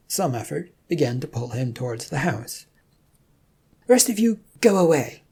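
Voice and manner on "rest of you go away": loud, high, shooing them off; dismissive order. Acted. Rum shooing Marcus's companions away, loud dismissive order. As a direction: send the rest packing, loud and high.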